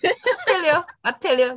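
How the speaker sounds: high, loud I tell you, fading; excited exclaim. Real, not acted. Laughing at losing the game, excited. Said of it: "Me losing it".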